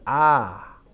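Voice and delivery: 아 held long as one drawn-out vowel. Shown as a demonstration. Teacher shows students a long vowel, drawn-out 아. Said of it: "길게 준 겁니다".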